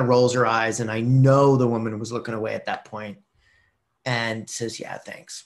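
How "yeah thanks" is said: barely voiced thanks; quiet mumble. Cashier quietly thanks the shopper in a murmur. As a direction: murmur it under your breath. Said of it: "really quietly".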